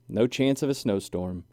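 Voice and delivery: usual speaking voice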